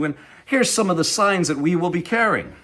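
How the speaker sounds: ordinary delivery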